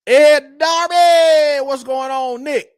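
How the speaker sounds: high, drawn-out holler of the name; shouted greeting. Real, not acted. Host greeting a viewer who just joined, big shouted hello. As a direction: hail them loudly, stretching the name out.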